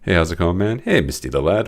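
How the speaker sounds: normal talking voice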